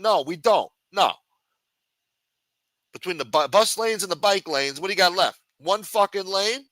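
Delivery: ordinary talk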